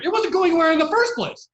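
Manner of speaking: high, loud outburst of disbelief; exasperated shout. Real, not acted. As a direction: yell it in outrage. Ranting about friend's failed relationship.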